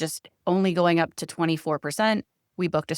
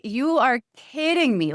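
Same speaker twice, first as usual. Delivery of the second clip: disbelief; 7 semitones higher, slower than usual. Retold or imitated. Hearing of an eighty-three minute delay, slow high disbelief. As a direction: drag it out in mock disbelief. Stuck in freeway traffic.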